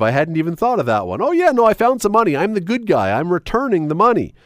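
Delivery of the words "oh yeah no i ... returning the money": voicing a fake-honest finder, higher and quick; mock good guy. Host imitating a caller pretending to be the good guy, lightly hammed-up. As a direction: play the smug good guy returning cash. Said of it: "that's the other answer that I suppose you could give".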